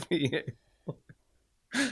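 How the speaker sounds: breathy laughing whisper. Retold or imitated. Man repeats the joke in a laughing whisper.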